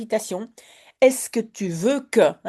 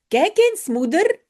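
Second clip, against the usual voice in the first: proud excitement; 7 semitones higher, 3 dB louder than usual. Acted. Jip shows mother flowers.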